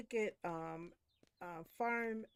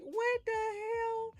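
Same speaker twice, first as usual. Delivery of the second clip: very high, very slow mock bewilderment; confused coworkers; much slower than usual. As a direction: drag it out like a baffled coworker. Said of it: "They be walking around like".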